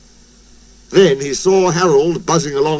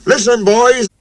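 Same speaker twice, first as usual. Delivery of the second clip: raised voice for attention; calling out; 7 semitones higher than usual. A narrator's reading. Fireman to the boys, raised call. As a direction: call the crew to listen. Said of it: "the fireman called".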